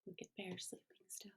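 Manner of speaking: hushed whisper so Bear won't wake. Real, not acted. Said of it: "aside while Bear sleeps".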